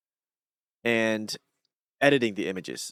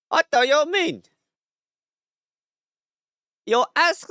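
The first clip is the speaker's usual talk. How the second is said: put-on high-pitched middle-aged Taiwanese man with a thick accent; Taiwanese accent, Abe; 12 semitones higher, 4 dB louder, slower than usual. Streamer playing his middle-aged alter ego, high Taiwanese accent. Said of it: "I love to do the accent".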